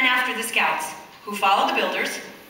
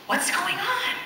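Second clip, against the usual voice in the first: curious asking; 8 semitones higher, slower than usual. A narrator's reading. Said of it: "they asked".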